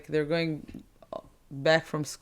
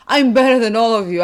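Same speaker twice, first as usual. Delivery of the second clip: mock boastful shout, pitched up; loud boast; 7 semitones higher, 12 dB louder than usual. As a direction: imitate a kid bragging loudly at bullies. Kid boasting to bullies, acted.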